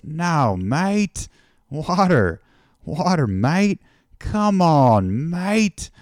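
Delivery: loud, high-swooping mock Australian "mate"; exasperated joker in a put-on Australian accent. Aussie local ribbing a visitor with a riddle, exaggerated Australian accent. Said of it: "sorry if this accent isn't working out".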